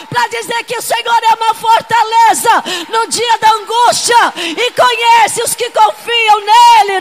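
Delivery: loud, fervent preaching cry; full-force preaching. Acted. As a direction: preach it to the congregation with fire.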